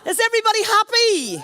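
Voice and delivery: high, loud shout to the hall; calling out. Real, not acted. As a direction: rouse the crowd. MC calling out to crowd.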